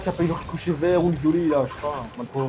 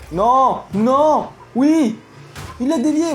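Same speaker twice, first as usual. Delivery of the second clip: tension criée; 7 semitones higher, 5 dB louder, much slower than usual. Real, not acted. Arrêt en direct.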